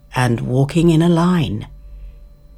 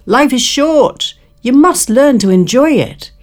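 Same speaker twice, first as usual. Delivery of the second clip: high, loud, cheery insect voice; carefree grasshopper; 9 semitones higher, 6 dB louder than usual. Acted. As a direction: call out like a happy-go-lucky grasshopper.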